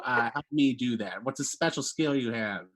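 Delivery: ordinary talk, nothing marked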